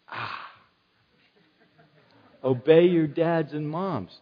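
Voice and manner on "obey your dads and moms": usual speaking voice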